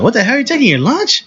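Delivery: high-pitched mock disbelief; imitating incredulous friend. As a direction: play your friend's stunned question, pitch shooting up. Friend stunned he is taking lunch.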